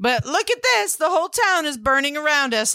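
put-on high, breezy teenage girl apology; playful high-pitched girl quote; mock girl voice. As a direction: lightly voice the sister shrugging it off. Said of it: "she's like".

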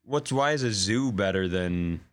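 usual speaking voice

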